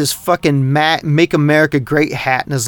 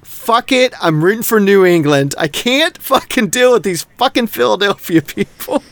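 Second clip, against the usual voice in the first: fed-up outburst; 6 semitones higher than usual. Retold or imitated. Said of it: "And I'm like".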